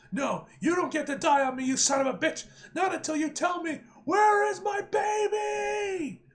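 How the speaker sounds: rising to a high, loud yell; desperate hero bellowing the question; furious shout. Acted. Hero demands answer from villain.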